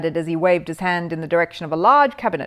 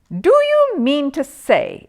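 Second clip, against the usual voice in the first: slow, higher and louder; exasperated exclamation; 8 semitones higher, 7 dB louder, much slower than usual. A narrator's reading. Policeman exclaiming at the aerial man. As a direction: drag it out in disbelief. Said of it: "exclaimed the policeman".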